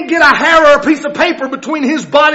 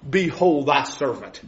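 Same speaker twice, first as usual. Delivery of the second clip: much lower and softer, bowed-down answer; humble reply; 9 semitones lower, 7 dB quieter than usual. Acted. Mephibosheth answers the king from the floor, low and soft. Lame man bows before king.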